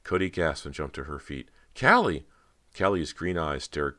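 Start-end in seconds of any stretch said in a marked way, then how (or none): 1.8-2.2 high, loud startled call; startled cry. A narrator's reading. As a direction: cry out the name in alarm. Startled cry on seeing her sister.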